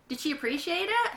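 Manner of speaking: ordinary delivery, nothing marked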